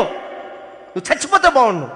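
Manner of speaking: loud, high and slow hateful yell; angry shout. Retold or imitated. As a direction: spit out నువ్వు చచ్చిపోతే బాగుండు slowly and loud. Son screaming at his mother.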